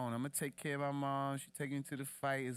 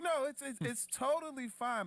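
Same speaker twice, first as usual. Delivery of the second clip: much higher, breezy totally-fine voice; 12 semitones higher than usual. Retold or imitated. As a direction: shrug it off like it's nothing. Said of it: "he was like".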